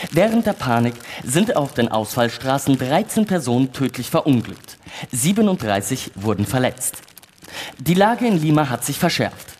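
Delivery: formal, even newsreader delivery; news read. Acted. Houston reading the evening news to the moon crew, formal news read. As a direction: read the bulletin like a radio news anchor.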